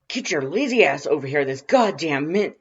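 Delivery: loud, irritated bellow at the phone; big brother roaring down the phone; angry yell. Acted. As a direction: shout him down, fed up. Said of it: "loud and gravelly and full of undisguised irritation".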